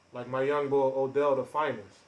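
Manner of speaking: ordinary talk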